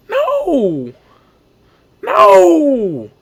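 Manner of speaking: long, high re-enacted wail; anguished denial. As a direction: draw out a cry of disbelief, building. Re-enacts reaction to season-ending news.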